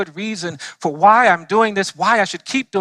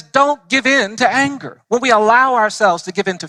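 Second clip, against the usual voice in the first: preaching; 6 semitones higher than usual. Acted. Preaching to a church audience.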